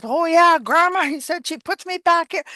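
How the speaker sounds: put-on high, loud little boy answer. Grandson answering grandma in a high little boy voice. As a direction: pitch up like a small boy. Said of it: "how that little boy said that".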